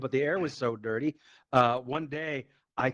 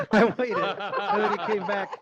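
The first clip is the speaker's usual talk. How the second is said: laughing; 7 semitones higher, 4 dB louder than usual. Real, not acted. Laughing at his own story.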